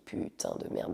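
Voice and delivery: juron soufflé sans voix, pour soi; juron chuchoté. Retold or imitated. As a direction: chuchote le juron dans ta barbe, sonnée. Pensée intérieure, panique soudaine. (juron chuchoté pour soi)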